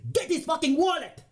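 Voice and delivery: high, harsh shouted demand; mugger's shout. Acted. Mugger orders his partner to grab the wallet, shouting. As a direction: bark it at the accomplice mid-robbery.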